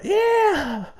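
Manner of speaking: high excited yelp; super excited. Acted. Player cheering the blocked arrow, high excited yelp. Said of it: "I get super excited".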